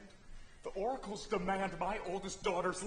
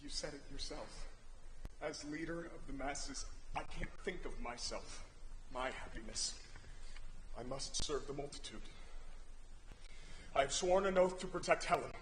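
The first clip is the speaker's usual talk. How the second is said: much softer, hollow and low; resigned grief; 5 semitones lower, 7 dB quieter than usual. Acted. King begging his brother, softly resigned. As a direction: speak it as if already lost.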